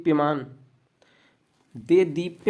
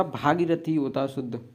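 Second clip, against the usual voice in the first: normal talking voice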